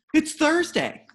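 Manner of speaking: high, loud, alarmed insistence; a frantic father imitated high and loud; agitated. Father panicking about garbage day.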